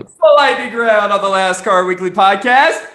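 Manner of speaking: announcer. Acted. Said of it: "announcing the segment".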